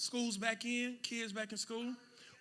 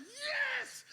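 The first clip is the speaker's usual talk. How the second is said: eager crowd yes. Retold or imitated. Crowd answering the tightrope walker.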